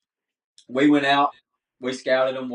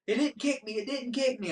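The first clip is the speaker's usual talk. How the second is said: put-on much higher, thrilled kid voice; excited young son; 12 semitones higher, 5 dB quieter than usual. Father retelling his son's first shot, high excited kid voice. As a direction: squeal it like a proud little boy.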